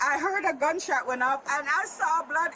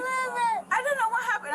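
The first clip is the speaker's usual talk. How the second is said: real tears breaking through the words; choking up while she explains; crying; 8 semitones higher than usual. Real, not acted. Grandmother telling police about the neck hold, crying.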